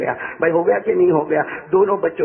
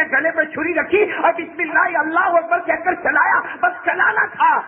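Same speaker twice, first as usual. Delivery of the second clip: high, urgent sermon storytelling; full-force preaching; 10 semitones higher than usual. Acted. Preacher telling of two boys playing with the knife, high and urgent. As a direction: drive the story upward to its climax.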